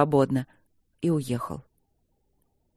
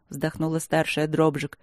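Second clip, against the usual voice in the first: ordinary delivery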